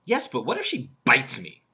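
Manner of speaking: "bites" punched after a slight hold; stress on bites. Shown as a demonstration. Speaker shows audience the proper way to land the joke, stress on "bites". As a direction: hit "bites" hard as the laugh word. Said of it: "I emphasize the bite".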